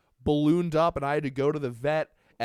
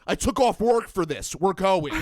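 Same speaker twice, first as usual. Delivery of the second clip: loud, raised reenacted scolding; yelling at cat; 4 semitones higher, 6 dB louder than usual. Man retells arguing with cat, yelling. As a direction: lay down the law loudly. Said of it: "Me yelling at the cat".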